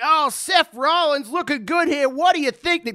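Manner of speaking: high, slow announcer patter; imitated high-pitched commentary; commentator intro. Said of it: "That's how he intros everyone in".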